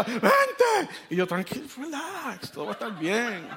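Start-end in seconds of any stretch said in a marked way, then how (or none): 0.0-0.9 grito agudísimo de urgencia, exagerado; esposa apurada imitada. Retold or imitated. Esposa de parto apurando al marido. 1.3-3.5 lento y agudo, con calma cómica; tranquilito exagerado. Retold or imitated. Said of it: "yo tranquilito, relax".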